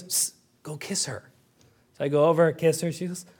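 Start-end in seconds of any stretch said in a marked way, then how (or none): 0.0-1.3 put-on hushed whisper of a little girl; child's whisper. Little Abby whispering to Daddy to kiss her. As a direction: whisper it like a kid sharing a secret.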